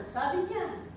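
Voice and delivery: ordinary delivery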